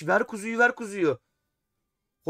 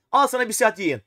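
louder, teasing comeback; mock-defiant answer to chat; playful retort; 4 semitones higher, 7 dB louder than usual. Real, not acted. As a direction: fire it back at the viewer. Answers viewer asking for long stream.

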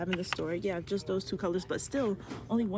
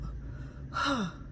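relieved sigh; 4 semitones higher than usual. Real, not acted. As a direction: let the air out after a long pitch. Catching breath after the pitch.

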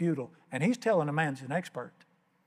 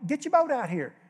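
higher, louder mock holler across water; 7 semitones higher, 4 dB louder than usual. Simon calling his partners, mock hollering. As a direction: call out to the other boat. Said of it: "They hollered".

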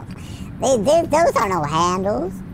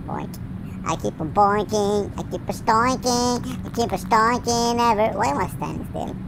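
normal talking voice

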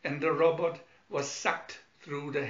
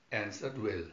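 plain reading voice

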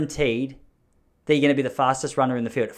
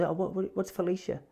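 higher, soft, puzzled voice; imitated puzzled mum; 4 semitones higher, 11 dB quieter than usual. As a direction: do a confused mum, small and hushed. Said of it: "and she goes".

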